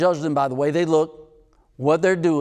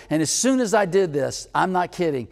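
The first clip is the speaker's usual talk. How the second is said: ordinary talk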